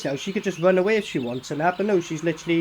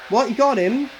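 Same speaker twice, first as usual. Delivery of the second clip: ordinary delivery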